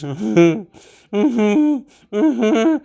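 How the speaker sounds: hammed-up mother's sobs through closed lips; imitated crying. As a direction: cry like a squealing pig. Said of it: "that's crying like a pig".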